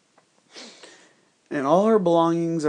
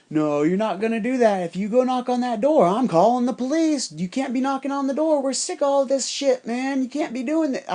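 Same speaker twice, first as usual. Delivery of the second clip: high, raised mock yell of an angry dad; retold shouting match; 9 semitones higher than usual. Angry dad yelling at the visitor at his door, high mock yell. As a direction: ham up a furious dad threatening police. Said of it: "we get in a shouting match".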